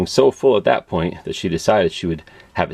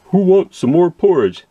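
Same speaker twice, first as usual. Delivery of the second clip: high, louder mock parent voice; playful question; 10 semitones higher, 6 dB louder than usual. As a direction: call it out like a playful cook. Asking kids for porridge.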